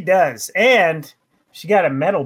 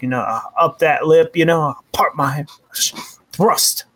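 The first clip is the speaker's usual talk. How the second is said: ordinary talk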